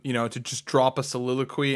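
usual speaking voice

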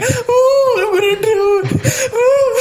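put-on high, loud whiny wail of a drowning child; mock crying kid. A kid in a two-foot pool wails in mock panic. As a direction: ham up a crying kid who thinks he'll drown. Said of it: "Okay, I'm crying".

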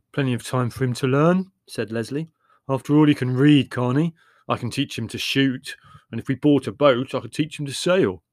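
a hint of a forceful brother pressing his plan; lightly voiced Leslie, confident. Voices Leslie in family debate.

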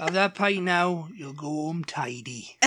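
put-on a Bristol accent, taken slowly and fondly. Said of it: "doing it in a Bristol accent".

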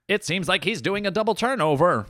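high, impressed play-by-play call; lightly voiced TV commentator; sports commentator. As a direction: call the turnover like an awed commentator. Mock sports commentator calling an event.

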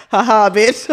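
ex-boyfriend laughing at his crying girlfriend, mocking laugh. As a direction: cackle it at her like a jerk laughing in her face.

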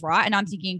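ordinary talk, nothing marked

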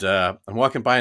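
ordinary delivery

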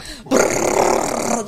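unvoiced lip-buzz rattle; imitating dash rattle. Describing the car's noise to the owner, buzzing rattle. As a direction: buzz your lips like a rattling dashboard. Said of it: "and it was like".

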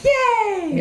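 high squeaky yay; mock cheer. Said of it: "so we have to be like".

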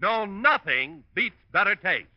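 ordinary talk, nothing marked

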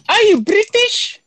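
high, slow mock British voice; playful put-on British accent.

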